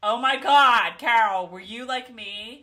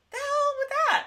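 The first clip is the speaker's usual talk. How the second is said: very high, a bit louder outburst; incredulous squeal; 5 dB louder than usual. Real, not acted. Host exclaims about Brie's pants, incredulous squeal. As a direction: squeak it out in disbelief.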